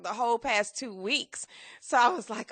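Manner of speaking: normal talking voice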